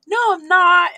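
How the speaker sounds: loud, high mock denial; imitating defensive kid. Daughter denying she is scared, loudly defensive.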